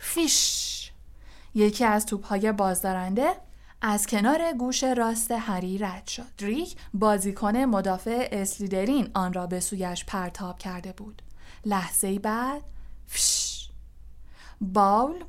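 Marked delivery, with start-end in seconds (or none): 0.0-0.9 high, hissed whoosh; whooshing noise. Acted. Bludger shoots past Harry's ear, hissed whoosh. 13.1-13.7 voiceless hissed swish; whooshing noise. Acted. Another Bludger flies past Harry, hissed whoosh.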